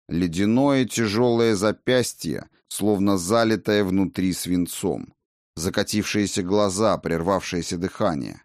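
ordinary delivery

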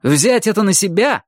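high, incredulous echo; indignant flare-up. A narrator's reading. Майки flares up at the Russian's apology, indignant. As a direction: throw the words back in outrage. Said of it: "вскинулся Майки".

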